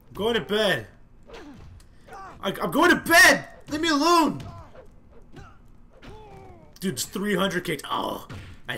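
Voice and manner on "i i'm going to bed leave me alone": frustrated yell. Real, not acted. Attacked while trying to sleep.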